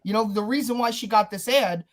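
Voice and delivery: normal talking voice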